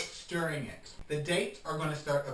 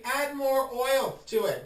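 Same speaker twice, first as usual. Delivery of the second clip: higher, drawn-out encouragement; slow coaxing; 9 semitones higher, 6 dB louder, slower than usual. Shown as a demonstration. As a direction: linger on the words like a sly nudge. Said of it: "if you feel the need".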